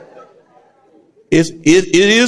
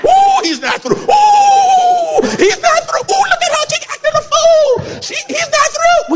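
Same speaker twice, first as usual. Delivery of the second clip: excited whoop. Real, not acted. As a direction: whoop it up, delighted at the crowd. Preacher whoops at congregation.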